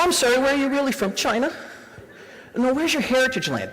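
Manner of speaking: lampoon the nosy stranger who won't let go; pushy questioner. Retold or imitated.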